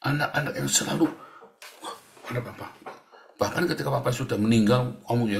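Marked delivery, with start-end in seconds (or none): 1.8-2.7 put-on frightened child whispering; hushed terrified child; scared whisper. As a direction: breathe it out in fear, barely voiced.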